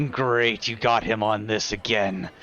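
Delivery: low, fed-up grumble; grumbling. Acted. Arvis to the party about Kaltog, a mild weary grumble. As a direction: roll your eyes through it.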